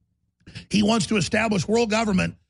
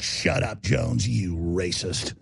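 low, slow sneer; deep slow mock brush-off; mocking dismissal; 9 semitones lower, 3 dB quieter, much slower than usual. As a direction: drag it out like a bored scoffer.

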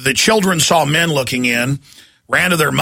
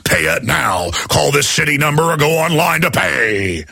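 booming, sing-song promo shout; monster truck ad. Retold or imitated. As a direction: threaten like a hyped radio promo. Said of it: "the same guy that does the monster truck ads".

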